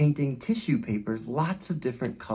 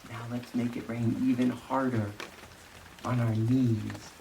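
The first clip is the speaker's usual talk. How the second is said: hushed, unhurried teacher leading a movement game; gentle guided movement; 6 dB quieter than usual. Acted. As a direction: guide the kids calmly through each shape. Guided movement storytime for children.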